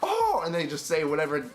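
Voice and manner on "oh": high surprised oh. Retold or imitated. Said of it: "And they're like".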